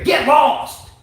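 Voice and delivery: high, loud dismissal; mock angry yell. Ranting host to the towel snatcher, mock angry yell. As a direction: yell it as a send-off.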